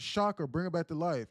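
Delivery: acting out a frantic shout; retold scream. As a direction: scream a desperate command at the medics. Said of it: "And he screamed at the ambulance".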